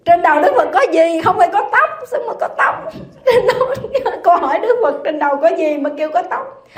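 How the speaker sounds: high, loud, breaking into laughter; laughing incredulity. Real, not acted. Teacher can't believe the class said Buddha has hair, laughing incredulously. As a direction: splutter the question through real laughter.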